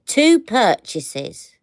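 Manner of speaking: loud, drawn-out disbelief; incredulous repeat. Real, not acted. Reacts to the store's condition.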